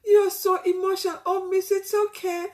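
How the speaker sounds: high-pitched imitation of concerned students; high, cooing student voice; sympathetic students. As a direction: imitate students soothing their emotional teacher, pitched right up. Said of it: "when the students were saying".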